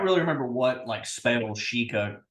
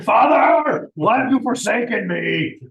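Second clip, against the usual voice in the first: high, loud and slow lament; dramatic cry; 8 semitones higher, 9 dB louder, slower than usual. Acted. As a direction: cry out to the sky, drawn out. Dramatic reply in character.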